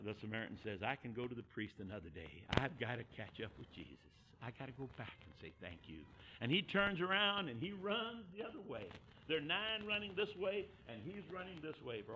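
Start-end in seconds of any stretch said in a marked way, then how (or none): 0.8-6.0 low, soft decision to oneself; quiet resolve. Retold or imitated. Samaritan deciding to go back and thank Jesus, quietly. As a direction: say it low, making up the mind.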